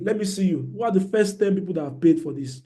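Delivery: usual speaking voice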